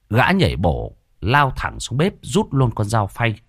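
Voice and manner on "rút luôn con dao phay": ordinary delivery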